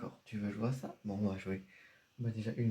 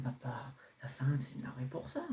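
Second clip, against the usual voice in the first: soothing whisper; 2 semitones higher than usual. Acted. Son calming his angry dad, quick hushed whisper. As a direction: whisper it fast, hushing.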